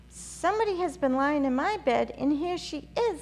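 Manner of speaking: high, loud squeaky little bear; sharp, shrill wee bear cry; little small wee voice. A narrator's reading. Baby bear finds Goldilocks in his bed, high and shrill. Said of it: "in his little small wee voice".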